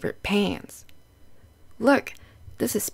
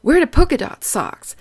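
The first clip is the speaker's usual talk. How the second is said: a lightly voiced bus driver, louder; bus driver's voice; 5 semitones higher, 6 dB louder than usual. Bus driver urging Pete to wear polka dot socks, lightly voiced and louder. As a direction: sing it out like a chatty driver.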